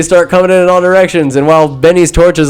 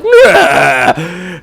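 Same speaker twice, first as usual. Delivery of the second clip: drawn-out mock animal bray; camel bleat; 3 semitones higher than usual. Camel refusing a smooch, mock bleat. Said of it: "the camel's like".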